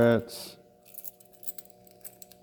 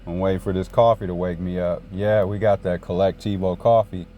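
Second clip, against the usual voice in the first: ordinary delivery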